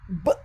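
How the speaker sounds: B said as its short sound, not its name; letter sound of B. Shown as a demonstration. Teacher sounding out the B for syllable building, letter sound. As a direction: say B as a bare "b" sound. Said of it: "é o som do B".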